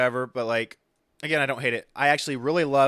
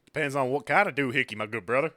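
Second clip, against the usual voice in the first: exaggerated Southern twang, quick at first; super Southern; faster than usual. Co-host answers about doohickeys, super Southern. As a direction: lay the Southern accent on way too thick. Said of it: "I just went super Southern".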